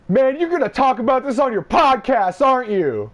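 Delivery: high, loud, strained mock voice of a drunk friend; imitating Justin. Friend imitates Justin asking about the podcast, high and loud. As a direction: squeeze it up high and loud like him. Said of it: "sound as close to exactly what he sounded like".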